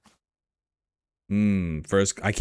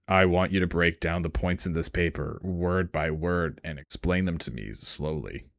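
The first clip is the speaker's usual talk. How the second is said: put-on deep e-boy voice; 5 semitones lower, 4 dB quieter than usual. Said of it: "Valorant e-boy voice".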